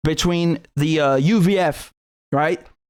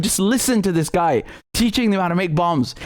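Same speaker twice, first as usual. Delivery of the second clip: ordinary delivery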